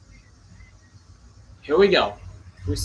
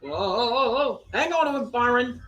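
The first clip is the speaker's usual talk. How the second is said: tense excitement; 6 semitones higher than usual. Real, not acted. Driver nearly loses car.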